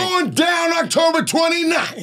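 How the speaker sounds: high, shouted hype call; hype man. Shown as a demonstration. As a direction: pump up the crowd like a hype man. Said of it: "I'll be your hype man".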